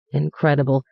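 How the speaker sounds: plain reading voice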